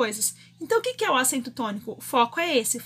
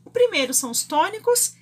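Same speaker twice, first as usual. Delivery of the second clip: ordinary delivery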